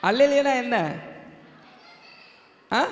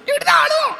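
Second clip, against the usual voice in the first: very high puppet-character voice; டிங்கன்; 14 semitones higher, 4 dB louder than usual. Pretend. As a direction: call out to the crowd in a squeaky high voice. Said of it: "டிங்கன் வந்து ஒரு கதை". (மிக உயர்ந்த பொம்மைக் குரலில்)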